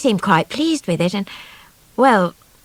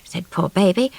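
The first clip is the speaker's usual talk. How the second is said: plain reading voice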